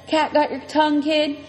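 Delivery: mock the silent kid; taunting biker.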